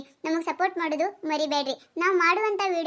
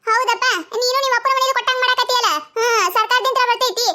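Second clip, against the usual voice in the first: lightly played indignant ration customer, raised pitch; pitched-up quarrelling customer; 7 semitones higher, 9 dB louder than usual. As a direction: argue back at the shopkeeper.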